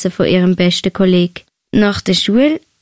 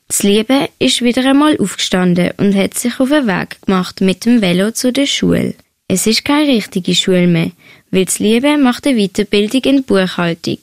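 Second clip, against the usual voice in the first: usual speaking voice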